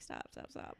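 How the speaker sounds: low, breathy hushed stop stop stop; whispered modest protest; hushed brush-off. Retold or imitated. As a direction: wave off the compliment under your breath. Brushing off praise.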